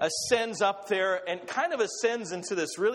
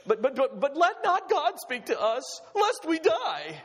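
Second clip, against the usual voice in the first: pleading fear; 8 semitones higher than usual. Acted. People begging God not to speak.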